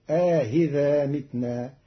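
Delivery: أإذا drawn out, second hamza softened after a long alif; slow, eased أإذا with inserted alif. Shown as a demonstration. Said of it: "فتصير". (تسهيل مع إدخال ألف)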